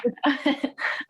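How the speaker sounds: short high giggle; a shy little laugh; nervous laugh. Real, not acted. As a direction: laugh off the nerves before reading. Nervous start of her reading.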